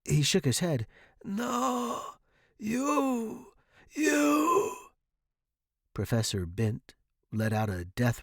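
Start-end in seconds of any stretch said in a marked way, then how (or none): 1.2-4.8 high, strained croaks; dying rasp. A narrator's reading. Dying professor to student, strained rasp. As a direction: force out each word with failing breath.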